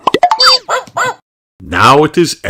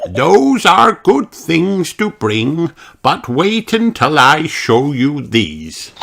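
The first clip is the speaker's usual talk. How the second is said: Grandpa Pig; 9 semitones higher, slower than usual. A narrator's reading.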